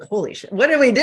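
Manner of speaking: retold thrilled shock, rising high and loud; excited disbelief. Acts out boss's thrilled shock.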